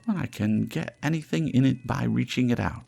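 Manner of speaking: high charwoman voice, a hint of coldness; lightly cold, dismissive old woman; coldly. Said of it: "returned the woman coldly".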